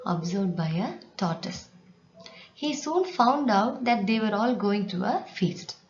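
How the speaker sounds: ordinary talk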